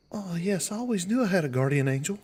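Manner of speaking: put-on high-pitched little girl voice; young elf girl. Elf girl chats about her guardian angel, childlike. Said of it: "you see a young elf girl".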